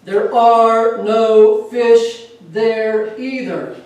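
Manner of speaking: loud, very slow booming voice from above; a hammed-up voice from above, loud and very slow. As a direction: boom it down from the sky. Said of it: "the voice comes".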